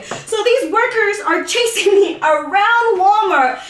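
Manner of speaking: normal talking voice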